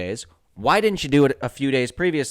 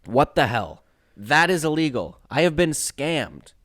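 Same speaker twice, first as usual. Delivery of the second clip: ordinary talk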